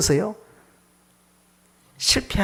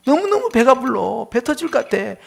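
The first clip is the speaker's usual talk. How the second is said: higher-pitched, playful boast of being stuffed; hammed-up fullness; 7 semitones higher, 3 dB louder than usual. Preacher acting out a contented person with no envy, hammed-up delight. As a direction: play someone overjoyed at being full. Acts out someone too full, escalating.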